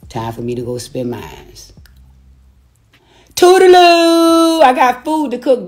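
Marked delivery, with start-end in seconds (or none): 3.4-5.7 high, slow, loud sing-song toodaloo; sing-song goodbye. Real, not acted. Signing off to viewers with a taunting high goodbye. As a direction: sing it out like a mocking farewell.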